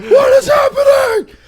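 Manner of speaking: high, drawn-out mock scream; panicked fish. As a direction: yell it as a flailing fish in shock. Acts out flopping fish's panic.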